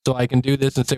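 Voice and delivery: ordinary talk, nothing marked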